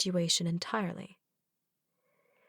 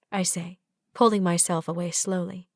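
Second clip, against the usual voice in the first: usual speaking voice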